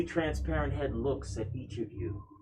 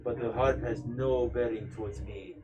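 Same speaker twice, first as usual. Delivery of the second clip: lower, soft ghost voice; calm ghostly prince; 3 semitones lower, 5 dB quieter than usual. Acted. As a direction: speak like a sad, calm spirit.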